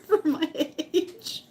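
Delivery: laughing through the word. Real, not acted. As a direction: dissolve into high laughter. Laughing at her immaturity.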